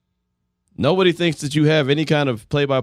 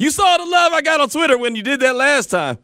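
hyped up; 9 semitones higher, faster than usual. Real, not acted. Hyped about Thiz dance.

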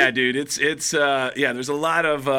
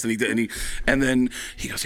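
ordinary talk, nothing marked

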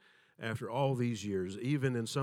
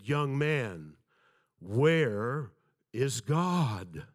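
louder and heavier pastor's voice, each word weighed; deeper voice; 6 dB louder, much slower than usual. Retold or imitated. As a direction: repeat it with more weight to scare the boy. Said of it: "once again in a deeper voice said".

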